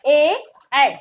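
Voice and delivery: ए then ऐ, said clearly and firmly; ए and ऐ demo. Shown as a demonstration. Teacher contrasts ए and ऐ for confused pupils. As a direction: contrast ए with ऐ from throat and palate. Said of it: "जीवा नीचे की ओर गई".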